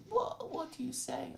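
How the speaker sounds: high-pitched, exasperated retold question; exasperated bafflement. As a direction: imitate a caller who can't make it out. Mimics baffled reaction to call centres.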